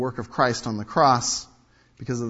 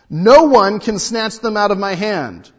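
proclaimed loud; 8 semitones higher, 11 dB louder than usual. Shown as a demonstration. Verse proclaimed then repeated.

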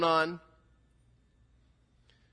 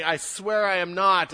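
loud, raised sworn denial; emphatic swearing; 5 semitones higher, 10 dB louder than usual. Acted. Peter swearing he is not a disciple, emphatic. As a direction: swear it forcefully. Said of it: "we know that he was swearing".

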